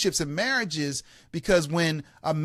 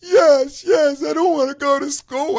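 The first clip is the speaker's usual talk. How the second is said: high, loud mock sobbing; imitating a tearful child's wail; crying six-year-old; 9 semitones higher, 7 dB louder than usual. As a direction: blubber agreement like a little boy. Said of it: "He said".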